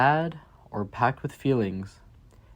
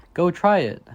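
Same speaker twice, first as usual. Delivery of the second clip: higher and louder, cheering them on; rousing encouragement; 6 semitones higher, 6 dB louder than usual. Acted. Reading to children, cheering them on to try it. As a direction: spur the listener to act. Closing rallying line to kids.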